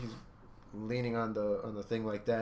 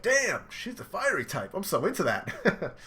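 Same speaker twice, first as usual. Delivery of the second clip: high, loud and laughing; smitten delight; 9 semitones higher, 5 dB louder than usual. Retold or imitated. Brother thrilled after Nami slaps Sanji. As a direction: gush over her, grinning. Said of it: "Yanji's like".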